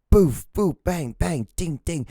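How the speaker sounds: punchy high cartoon hit sounds; high-pitched boof, bang and ding effects; imitated fight noises.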